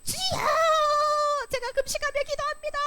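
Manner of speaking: hammed-up public prayer wail; mock showy loud prayer. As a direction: imitate someone praying loudly to be seen. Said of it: "큰 소리로 나가서".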